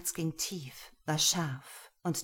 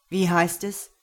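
dominant master; 7 dB louder than usual. Acted. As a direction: speak as a pleased, cold master. Master demands thanks. (Herr spricht zufrieden und dominant zu Lena)

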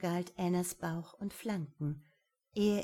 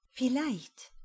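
higher, hesitant concession; conceding; 6 semitones higher, 3 dB louder than usual. Acted. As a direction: admit it reluctantly. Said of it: "räumte Anna ein".

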